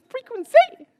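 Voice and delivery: loud high call. Shown as a demonstration. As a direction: sing out "Frequency" high and loud. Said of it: "It's my frequency".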